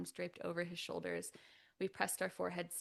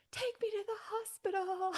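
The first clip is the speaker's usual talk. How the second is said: put-on whiny little kid; 6 dB louder than usual. Childish plea for attention.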